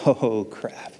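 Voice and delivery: low, sinking mutter of dismay; mock deflated groan. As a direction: let it sag out under your breath. Dismayed at the invitation.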